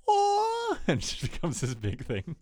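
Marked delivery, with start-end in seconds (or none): 0.1-0.8 very high falsetto "Aw" with a teary cry; touched aw. Retold or imitated. Elliot touched by the confession, squeaky teary aw. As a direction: coo it like you're moved to tears.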